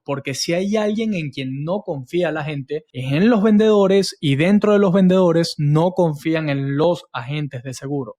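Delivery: ordinary talk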